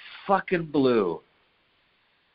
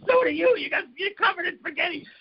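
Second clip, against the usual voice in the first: high, giggling retort; laughing comeback. Retold or imitated. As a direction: laugh right back at him. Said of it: "And I was like".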